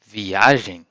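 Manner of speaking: viagem as vee-A-zhen, nasal end; Portuguese viagem. Shown as a demonstration. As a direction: model word viagem. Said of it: "Como você diz travel".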